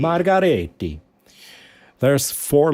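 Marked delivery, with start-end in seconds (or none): 0.0-1.0 put-on Margaretti rolled in a light Italian accent; playful Italian accent; mock Italian. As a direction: give Margaretti an Italian lilt. Echoes the Italian name.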